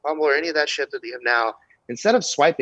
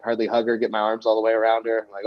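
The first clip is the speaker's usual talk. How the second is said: ordinary talk, nothing marked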